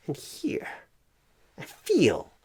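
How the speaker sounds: normal talking voice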